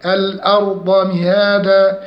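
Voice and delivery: الأرض مهادا with thin hamza and heavy r; measured chant of الأرض مهادا; light hamza. Shown as a demonstration. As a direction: keep the first a of الأرض light. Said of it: "نحافظ على ترقيق الهمزة".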